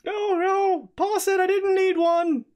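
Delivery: very high squeaky goblin voice; excited little goblin squeak. Acted. Goblin Prince voice.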